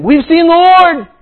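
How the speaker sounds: high, loud, slow proclamation; excited announcement. Acted. Disciples telling Thomas, high excited shout. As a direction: burst out with joyful news.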